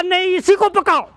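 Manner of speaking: high-pitched insistent order; insistent refusal. Acted. Father refusing the captured monkey's plea, insistent command. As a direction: refuse firmly, pitch jumping well up.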